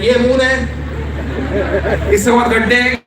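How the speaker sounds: high, fast, dismissive tour guide in Hindi; impatient tour guide brushing off the tourists; mimicking the tour guide. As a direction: rattle it off like a bored guide waving you on. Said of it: "he would have been like".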